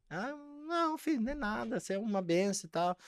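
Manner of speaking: mãe desconversando. Retold or imitated. Mãe desconversa com o filho.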